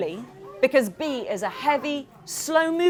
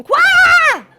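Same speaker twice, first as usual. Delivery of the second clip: very slow, much louder, a drawn-out scream breaking off; a frightened dinosaur losing his nerve; panicked scream; 14 dB louder than usual. Acted. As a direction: let the hush burst into a scream. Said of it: "Prince stops screaming".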